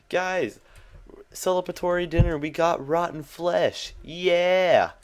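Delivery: high, loud hammed-up cheer; mock celebration. Gamer announcing a rotten flesh dinner to viewers, mock-jubilant. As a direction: cheer it as if it were a feast, ending in a big whoop.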